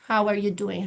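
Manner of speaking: usual speaking voice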